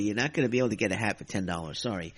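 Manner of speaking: usual speaking voice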